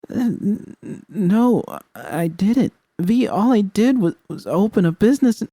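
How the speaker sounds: very high, strained stutter; stammering denial. Acted. Narrator stammers her defence to V, very high and strained.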